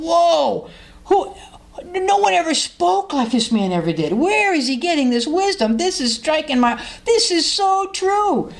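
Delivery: high, awed voices of the crowd; amazed listeners. Retold or imitated. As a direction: marvel at every word. Crowd amazed by Jesus' words.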